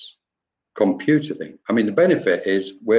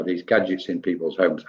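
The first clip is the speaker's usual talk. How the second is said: normal talking voice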